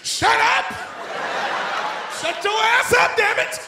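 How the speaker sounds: put-on old-before-his-time kid shouting at classmates; yelling at kids. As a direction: scream at the crying kids. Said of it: "I'm like".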